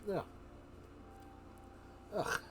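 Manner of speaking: normal talking voice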